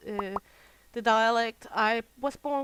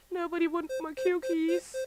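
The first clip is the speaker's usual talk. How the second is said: playful plaintive call; 7 semitones higher than usual. Pretend. Player offering unwanted cukies to others, playful high plea. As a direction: call it out high, like a neglected seller.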